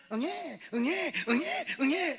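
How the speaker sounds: high slow mock baby wail; hammed-up baby crying; imitated baby cry. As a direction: draw out an infant's wail.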